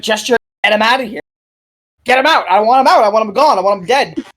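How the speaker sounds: mild mock panic; panicked demand. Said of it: "as he's like".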